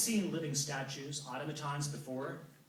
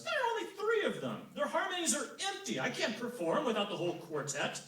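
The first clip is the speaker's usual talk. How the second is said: scoffing god; 11 semitones higher than usual. Acted. Apollo scoffs at three singers.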